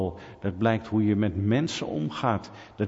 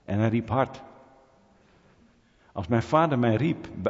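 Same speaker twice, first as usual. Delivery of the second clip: usual speaking voice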